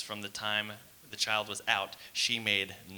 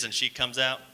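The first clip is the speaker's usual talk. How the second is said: normal talking voice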